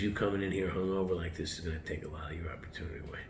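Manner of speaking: fast whispered warning from a mentor; hushed warning. Retold or imitated. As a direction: lean in and whisper it, quickly. Mentor's quiet warning, retold.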